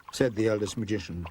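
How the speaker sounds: usual speaking voice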